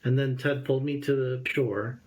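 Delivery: ordinary talk, nothing marked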